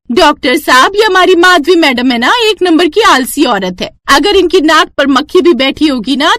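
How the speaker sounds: high-pitched neighbour giving a long scolding. Acted. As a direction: neighbour Kamla scolds the lazy mother-in-law in front of the doctor. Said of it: "पड़ोसन कमला कहती है". (ऊँची आवाज़ में डाँटती पड़ोसन)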